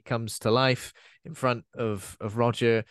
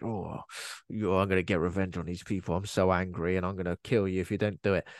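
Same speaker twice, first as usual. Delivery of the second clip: low, mock-menacing villain; Sammy Kerr; 7 semitones lower, 4 dB quieter than usual. Vengeful ghost threatening people, low mock menace. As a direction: drop low for a one-note vengeful threat. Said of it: "Sammy Kerr is very like".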